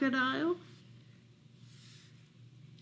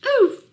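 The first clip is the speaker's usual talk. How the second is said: very high loud oof; high shouted game oof; Roblox oof; 14 semitones higher, 10 dB louder than usual. Retold or imitated. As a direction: squeak it like the game death sound. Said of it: "as you might hear on your favorite game".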